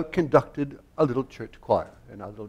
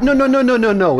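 high, loud rattled-off denial; mock-alarmed protest, pitched up; hasty protest; 11 semitones higher, 8 dB louder than usual. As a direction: cut in with a string of urgent corrections. Acts out own hasty correction.

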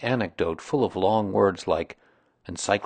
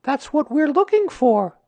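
put-on high, loud boy's voice; pitched-up young boy; Christopher Robin; 8 dB louder than usual. A narrator's reading. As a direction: play a child stating the plan. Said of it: "said Christopher Robin".

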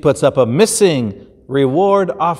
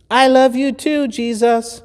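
much higher small lamb voice; little lamb voice; 11 semitones higher than usual. Acted. Lamb answering Jesus, high and sweet.

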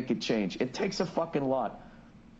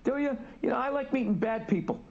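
much higher, mock-incredulous retort; sarcastic comeback; 9 semitones higher than usual. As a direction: throw his line back at him with sarcasm, pitched way up. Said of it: "And I was just like".

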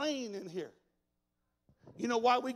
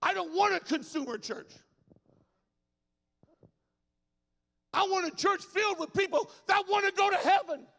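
loud, high, shouted sermon lines; full-force preaching; 9 semitones higher, 8 dB louder than usual. Acted. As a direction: preach it like the church is on fire.

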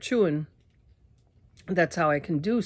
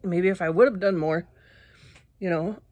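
ordinary talk

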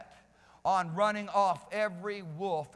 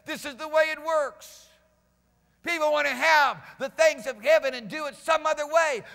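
raised, loud pulpit delivery; full-force preaching; 5 semitones higher, 6 dB louder than usual. Real, not acted. Preacher urging the congregation to follow, full-force preaching. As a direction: build to a high, forceful preaching shout.

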